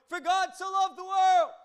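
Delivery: loud, high, drawn-out declaration; mock proclamation. Preacher parodies Jesus addressing the whole world, mock proclamation. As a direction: shout it slowly like an announcement to crowds. Mimes a mountaintop proclamation, then says no.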